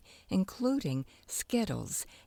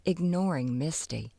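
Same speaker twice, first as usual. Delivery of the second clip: ordinary delivery, nothing marked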